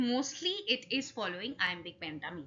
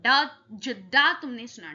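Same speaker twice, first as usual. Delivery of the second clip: ordinary delivery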